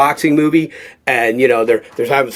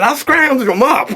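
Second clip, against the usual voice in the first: mock voice; 12 semitones higher, slower than usual. Retold reply to director.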